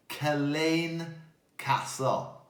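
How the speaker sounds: Culzean said kul-AYN, then Castle; Scottish place name. Shown as a demonstration. Teacher repeating Culzean Castle to the children. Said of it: "I now know how to say the word".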